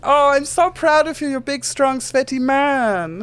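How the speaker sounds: squeaky little genie gushing over Titus; small Germanic genie. Acted. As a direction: play a tiny fussy genie, flustered.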